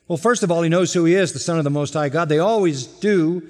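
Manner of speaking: usual speaking voice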